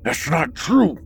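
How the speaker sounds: high frantic protest; desperate insistence. Acted. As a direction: insist it, near tears.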